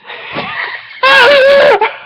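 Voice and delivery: high squealing burst of laughter; a helpless high cackle; shrieking laugh. Real, not acted. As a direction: lose it completely mid-line. Reader cracks up.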